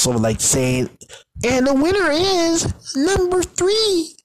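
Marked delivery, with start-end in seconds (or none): 1.4-4.1 high, drawn-out announcer call; game show host. Retold or imitated. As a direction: announce the winner in a sustained, high-pitched call. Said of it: "like she's a game show host".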